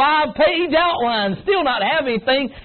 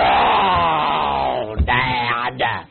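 grizzly bear whine; 11 semitones lower than usual. Retold or imitated. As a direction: groan it like a bear. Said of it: "They sound like a grizzly bear".

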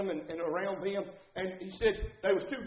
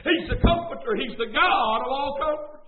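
higher, driving, impassioned pulpit cry; full-force preaching; 6 semitones higher, 4 dB louder than usual. Real, not acted. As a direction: build into a loud, high sermon climax.

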